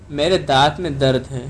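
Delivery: usual speaking voice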